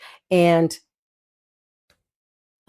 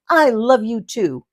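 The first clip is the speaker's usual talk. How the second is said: slow, higher and louder playful reply; stranger replying; 6 semitones higher, 6 dB louder, slower than usual. Retold or imitated. The stranger answering her joke, higher, slow and playful. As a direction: sing it back sweetly, slow. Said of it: "He goes".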